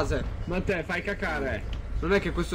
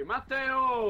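raised, higher-pitched name call; 5 semitones higher, 4 dB quieter than usual. Real, not acted. Player calling Matteo who has dozed off, calling out. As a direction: call a sleeping friend to attention.

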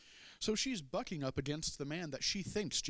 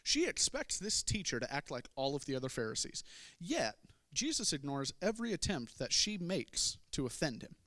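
ordinary talk

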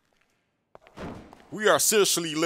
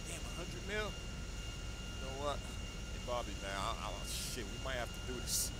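quiet; 5 semitones lower, 17 dB quieter than usual. Acted. Tempted by the bribe.

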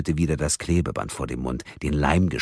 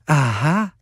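smiling; 12 semitones higher, 5 dB louder than usual. Acted. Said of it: "sagte Karen lächelnd".

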